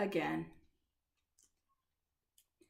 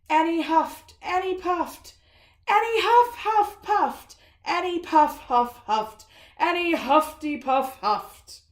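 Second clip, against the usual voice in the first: louder, higher tumbling huffs; frantic huffing; 6 semitones higher, 9 dB louder, slower than usual. A narrator's reading. Reading the wolf's frantic huffing, louder and higher. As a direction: pile up the huffs breathlessly.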